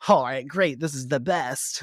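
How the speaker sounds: hammed-up fanboy glee; Ford. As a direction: gush slowly in a high mock-excited voice. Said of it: "Ford gets all excited".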